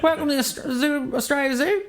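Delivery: high-pitched broad Australian caricature; hammed-up Aussie zookeeper greeting; Steve Irwin. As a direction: put on an exaggerated, high Australian accent.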